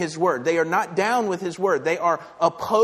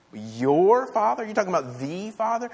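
stretched, pitched-up incredulity; incredulous challenge; 6 semitones higher, 4 dB louder than usual. Acted. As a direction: echo it back in scandalized disbelief.

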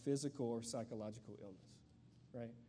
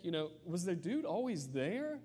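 usual speaking voice

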